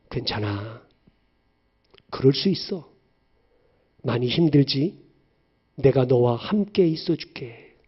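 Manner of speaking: the Lord speaking softly and kindly; tender comfort. Acted. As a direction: soothe a hurting child. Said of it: "주님은 이렇게 말씀하시죠".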